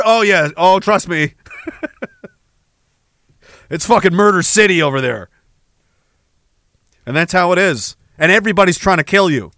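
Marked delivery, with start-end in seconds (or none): none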